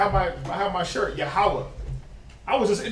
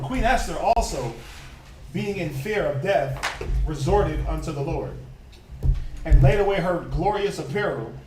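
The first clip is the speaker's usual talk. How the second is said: measured reading of Esther; scripture reading; 2 semitones lower than usual. Acted. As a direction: read the verse steadily.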